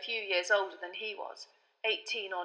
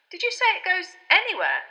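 put-on boy's voice, higher; 10 semitones higher, 8 dB louder than usual. A narrator's reading. Harry voiced in the story.

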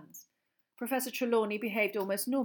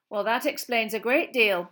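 tart; 3 semitones higher, 11 dB louder than usual. A narrator's reading.

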